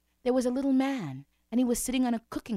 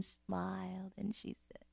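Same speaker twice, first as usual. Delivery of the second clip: whisper; 11 dB quieter, slower than usual. A narrator's reading. Narration hushed before reveal.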